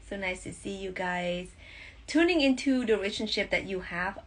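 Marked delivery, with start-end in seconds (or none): none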